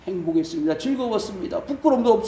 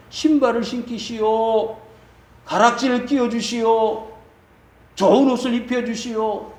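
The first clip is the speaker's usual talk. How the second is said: father's proclamation; 4 semitones higher than usual. Retold or imitated. Father ordering servants to dress his returned son, acted proclamation. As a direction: call out orders like a rejoicing master.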